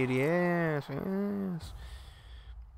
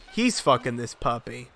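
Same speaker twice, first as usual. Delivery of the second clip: ordinary talk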